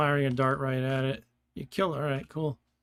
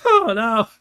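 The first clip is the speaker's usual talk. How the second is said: much louder, higher laughing oh no; laughing dismay; 8 semitones higher, 12 dB louder than usual. Real, not acted. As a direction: gasp it out through laughter. Realises he killed Lord British.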